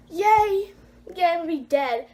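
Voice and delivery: high, loud fake "yay"; mock-happy burst, sinking a little after; sarcastic cheer. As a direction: cheer your own doom. Mock cheering at coming trouble.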